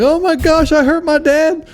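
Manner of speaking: put-on high-pitched whiny kid voice; mock child's falsetto; kid's voice. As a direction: squeak it out like a guilty little kid. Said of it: "And they'll be like".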